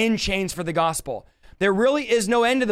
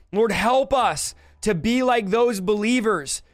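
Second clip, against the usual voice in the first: impassioned prayer rising in pitch; fervent prayer; 4 semitones higher than usual. Real, not acted. Preacher praying for the Holy Spirit, fervent and rising. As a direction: plead with God, building phrase by phrase.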